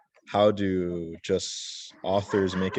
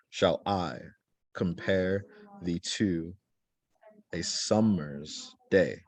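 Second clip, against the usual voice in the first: slow, measured "Shall I compare thee to a summer's day", stressing I, -pare, to, sum-, day; iambic scansion; slower than usual. Shown as a demonstration. Teacher scanning a Shakespeare sonnet line, "Shall I compare thee to a summer's day" in slow unstressed-stressed beats. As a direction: scan "Shall I compare thee to a summer's day" slowly, weak then strong, foot by foot. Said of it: "'Cause it's unstressed, stressed".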